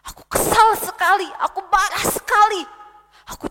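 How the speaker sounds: petulant angry outburst; angry king. Acted. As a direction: vent like a sulking king. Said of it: "dia juga marah-marah dan kesal".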